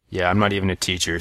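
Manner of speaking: ordinary talk, nothing marked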